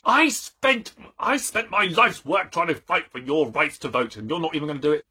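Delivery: rally them with exasperated mock outrage; hammed-up indignant campaigner. Old suffragette scolds girls over voting rights, mock indignation.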